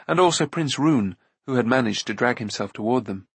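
ordinary delivery

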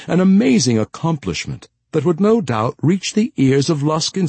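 lofty, lilting flattery in a lifted voice; old steward Temagast, flattering. A narrator's reading. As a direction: build up grand praise to tempt a proud lady.

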